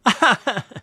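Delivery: a quick amused laugh; thief's laugh. Acted. Thief laughing at Tom's bold offer, short chuckle.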